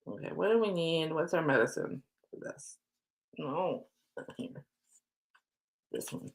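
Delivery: ordinary delivery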